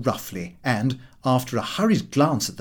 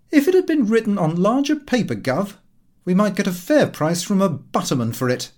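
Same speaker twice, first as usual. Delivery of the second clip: mocking son, pitched up and louder; jeering; 7 semitones higher, 4 dB louder than usual. A narrator's reading. Son jeering at his father's diary. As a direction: sneer it at your father. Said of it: "in a jeering tone".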